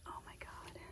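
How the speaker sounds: hushed awed whisper. Real, not acted. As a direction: whisper so you don't scare the birds. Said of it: "I don't know why I'm whispering".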